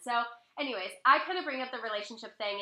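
usual speaking voice

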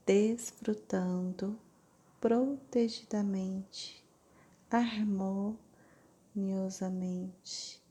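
slow, syllable by syllable; over enunciating. Shown as a demonstration. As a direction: stretch every syllable out slowly. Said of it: "lentamente, sílaba por sílaba". (pronunciando sílaba por sílaba)

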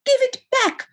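very high, squeaky demand; piping kid brother's protest; wheezing little brother. A narrator's reading. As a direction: squeal it high and squeezed, wanting it back. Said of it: "wheezed Laz".

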